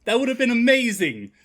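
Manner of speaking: loud, high burst of delight; light up at the idea; gleeful exclamation; excited. Real, not acted. Excited reaction to casting idea.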